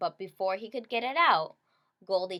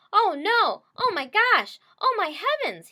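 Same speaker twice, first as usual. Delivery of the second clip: high, loud little bear crying out; frantic baby bear; wee little bear voice; 11 semitones higher, 9 dB louder than usual. A narrator's reading. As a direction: squeak it out like a tiny cub in alarm. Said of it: "he cried in his wee little voice".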